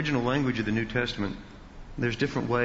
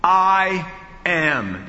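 I AM loud, high and drawn out; booming, emphatic I AM, both words hit hard; 7 semitones higher, 8 dB louder than usual. Shown as a demonstration. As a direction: proclaim each word of I AM with full force. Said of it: "If you want to say very emphatically".

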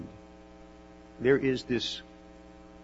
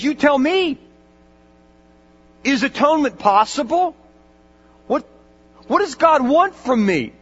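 louder, higher, pleading dying man; desperate plea; 11 semitones higher, 9 dB louder than usual. Retold or imitated. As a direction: cry out to the chaplain for an answer.

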